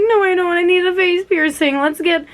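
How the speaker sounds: playfully demanding; mild mock whine; retold protest. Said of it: "I'm like".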